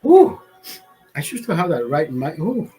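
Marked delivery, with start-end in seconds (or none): 0.0-0.4 pained ooh. Real, not acted. After coughing fit.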